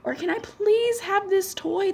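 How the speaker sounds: put-on high, drawn-out pleading kid voice; begging child. Little kid begging mom for candy, high wheedling plea. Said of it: "used to beg your mom when you were little".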